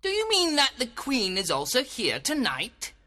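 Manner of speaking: high, incredulous question; surprised. A narrator's reading. Puck checking whether Titania is coming, surprised. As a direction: ask in astonished high pitch. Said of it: "Puck cried in surprise".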